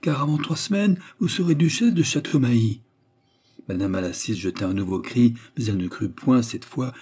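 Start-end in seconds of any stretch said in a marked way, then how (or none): 0.0-2.8 higher, fervent declaration; emphatic promise. A narrator's reading. Duke promising the widow marriage, fervent. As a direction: proclaim it with growing fervour. Duke proclaims she will be duchess.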